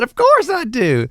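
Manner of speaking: eager yes. Retold or imitated. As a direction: soar up, savouring every word. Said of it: "I said".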